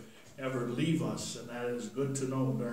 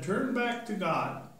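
much higher, urgent call; Peter preaching; 8 semitones higher than usual. Acted. Peter urging the crowd to repent, high and urgent.

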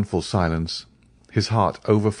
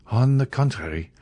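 usual speaking voice

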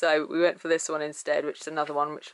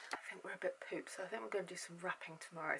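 whispering; 18 dB quieter, faster than usual. Real, not acted. Tired, quiet sign-off.

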